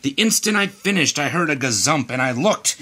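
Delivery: usual speaking voice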